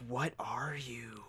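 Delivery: very soft, drawn-out mock awe; hushed disbelief. Recalling a classmate who read it quick, hushed disbelief. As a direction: draw it out quietly, as if baffled. Awed at fast-reading classmate.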